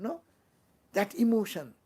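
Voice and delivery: usual speaking voice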